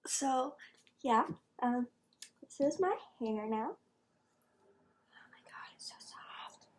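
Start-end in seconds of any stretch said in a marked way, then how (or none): 5.1-6.7 breathy hushed whisper. Real, not acted. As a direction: whisper it like a secret. Gushing about soft hair.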